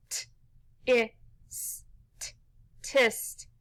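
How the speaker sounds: T, I, S, T as isolated short sounds, then "tist" whole; sounded out. Shown as a demonstration. Teacher tapping out the second syllable, sounded out. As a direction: tap out "T, I, S, T" sound by sound with gaps, then blend to "tist". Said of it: "let's tap this one out".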